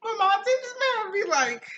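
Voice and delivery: can't stop laughing; giggling apology. Real, not acted. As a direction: apologize through high, loud laughter. Laughing fit.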